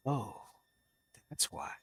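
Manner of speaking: quiet realization. Retold or imitated. Husband realizes why he yelled at his wife, quietly stunned.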